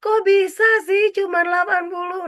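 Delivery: high, loud, incredulous demand; perfectionist parent. Acted. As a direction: act a parent shocked by a child's score. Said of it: "orang tua yang perfeksionis, ya Allah".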